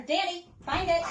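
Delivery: high, loud cue to the dog. Real, not acted. Trainer cueing the dog to find treats, high and loud. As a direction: call it out to pull her attention.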